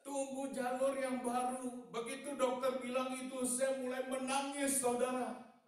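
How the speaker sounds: loud, very high triumphant testimony; full-force preaching. Real, not acted. Preacher telling the congregation he wept for joy, full-force preaching. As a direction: proclaim the miracle high and loud to the crowd.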